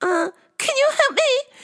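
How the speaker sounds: very high, sing-song calling; hammed-up high customer voice; mock strange customer. Customer calling the worker over, very high mock voice. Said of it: "and she goes".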